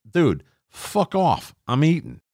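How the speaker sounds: high, drawn-out mock dismissal; hammed-up annoyed retort; exasperated brush-off. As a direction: wave off the intruder, stretching it out slowly. Imagined retort to intruding manager.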